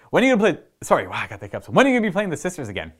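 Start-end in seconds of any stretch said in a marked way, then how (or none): 0.1-0.6 high, eager fan asking. Retold or imitated. Reading out fan emails, high and eager. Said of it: "I've even gotten emails about". 1.7-2.9 high, eager fan asking. Retold or imitated. Reading out fan emails, high and eager. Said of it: "I've even gotten emails about".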